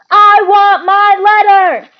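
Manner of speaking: very high, very loud, slow furious shout. A narrator's reading. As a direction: scream for what's yours. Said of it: "he shouted".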